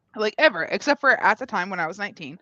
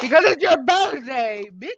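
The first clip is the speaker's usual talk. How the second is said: playful shout; 4 semitones higher, 5 dB louder than usual. Real, not acted. Teasing shout at friend.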